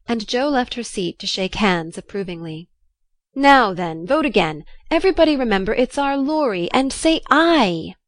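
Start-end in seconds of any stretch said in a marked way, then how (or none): 3.3-7.9 louder rallying cry, rising in pitch; rally the voters, drawing out the last words; excited. A narrator's reading. Snodgrass calls everybody to vote again, excited. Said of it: "cried Snodgrass excitedly".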